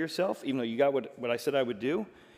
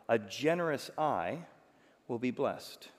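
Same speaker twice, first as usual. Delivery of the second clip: usual speaking voice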